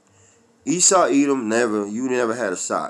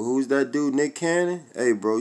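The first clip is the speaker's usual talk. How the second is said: usual speaking voice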